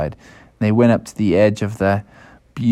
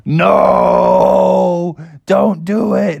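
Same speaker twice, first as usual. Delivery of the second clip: higher, drawn-out warning cry; raised, alarmed cartoon parent voice; pleading bear parents; 6 semitones higher, 3 dB louder, much slower than usual. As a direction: wail a long no, then plead. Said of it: "they were all like".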